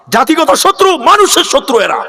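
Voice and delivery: high, forceful preacher's condemnation; denouncing. Real, not acted. Preacher condemning men to the gathering, high forceful denunciation. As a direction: cry it out as an accusation.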